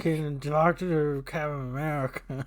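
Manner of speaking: Adam Sandler voice. Retold or imitated. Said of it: "they're like".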